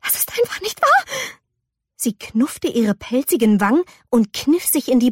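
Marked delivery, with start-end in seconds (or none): 0.0-1.0 fast, high-pitched squeal; panicky denial. Acted. May refuses to believe her reflection, panicky. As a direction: rush it out, high and frantic.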